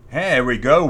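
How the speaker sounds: ordinary talk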